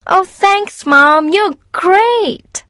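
put-on very high, thrilled girlish voice; delighted daughter. A narrator's reading. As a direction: thank Mum with delight. Julie thrilled to get permission.

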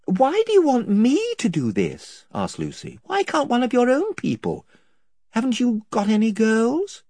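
lightly voiced girl, confused; young girl Lucy. A narrator's reading. Lucy asks.

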